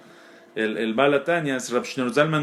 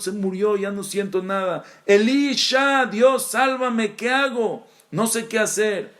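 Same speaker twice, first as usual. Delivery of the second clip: desperate plea; 7 semitones higher than usual. Acted. The soul begging Elisha for help, desperate cry.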